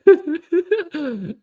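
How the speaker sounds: sped-up chipmunk glitch; squeaky high-pitched mic glitch; helium voice. Real, not acted. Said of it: "[name], you sound like [name]".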